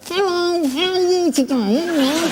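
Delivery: very high, small squeaky voice, slow; a little man talking from inside a cigarette packet; tiny voice from a box. Acted. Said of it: "a character who used to talk from inside a box".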